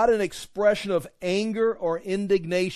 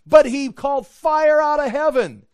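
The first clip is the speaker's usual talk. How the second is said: loud, high-pitched acted objection; awed protest; 9 semitones higher, 10 dB louder than usual. Preacher playing someone wowed by a false prophet's sign, loud awed protest. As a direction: cry it out as an amazed believer.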